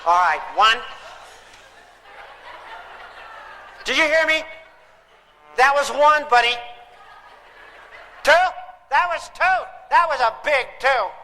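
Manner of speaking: hammed-up parent counting to three. Parent counting down at a stalling kid, hammed-up.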